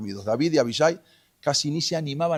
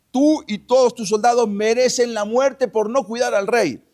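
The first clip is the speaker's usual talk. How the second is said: high, loud accusing call; shouted rebuke; 8 semitones higher, 5 dB louder than usual. Retold or imitated. David scolds Abner for not guarding the king, loud rebuke. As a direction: berate him from a distance, pitch leaping up.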